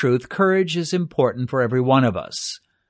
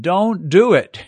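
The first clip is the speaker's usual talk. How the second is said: raised, slow, emphatic protest; mild mock scream; 5 semitones higher, 4 dB louder, slower than usual. The flesh warning you off a risky decision, mildly screamed. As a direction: hold back a scream, higher and drawn out. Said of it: "Your flesh will scream".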